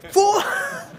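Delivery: very high holler of a name; demonstrated parental shout; mock calling shout. Shown as a demonstration. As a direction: yell for a child who is far off. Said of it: "teriak-teriak".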